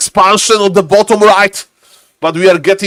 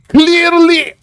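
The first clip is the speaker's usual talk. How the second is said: sarcastic echo; 7 semitones higher than usual. Acted.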